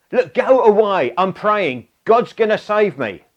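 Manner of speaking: mock-annoyed, shooing the rescuer off; irritated shout. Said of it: "Now [name]'s getting irritated".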